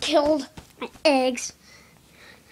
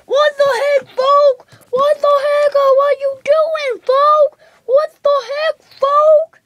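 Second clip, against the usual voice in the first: outraged yelling; 10 semitones higher, 7 dB louder than usual. Pretend. Player protesting what the other is doing, loud high yelling. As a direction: yell it in high-pitched play outrage. Outraged outburst during pretend play.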